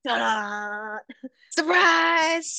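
hammed-up showy reveal, rising to a laugh; dramatic ta-da. Shown as a demonstration. Teasing her friend about her reveals, mock-showy ta-da. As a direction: present it like a magic trick. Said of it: "when I get all dramatic about it".

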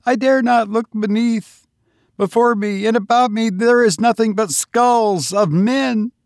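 high, terrified pleading voice; pilgrim clinging to the skulls, voice pitched high in fear; trembling fear. A narrator's reading. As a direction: speak like you're frozen on a cliff edge. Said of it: "trembling and clinging".

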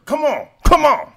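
high, loud excited pleading; excited urging. Real, not acted. Forcing toys to match.

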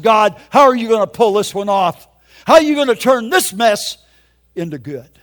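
re-enacted yell to heaven; retold screaming. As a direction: cry out at God in protest. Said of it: "I screamed at God".

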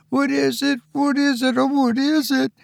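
high, panicky screech. A narrator's reading. Luella screeches at the steaming cup, high and frightened. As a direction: shriek it out like a frightened girl. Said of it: "she sort of screeches out".

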